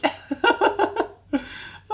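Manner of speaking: high startled laugh at her own voice; real laugh. Real, not acted. Teacher laughing at her own voice crack, high real laugh. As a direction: laugh out at how the note came out.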